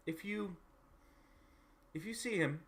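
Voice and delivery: start, stop, then give in and ask; lightly voiced visitor, reluctantly asking a favour; hesitant game character.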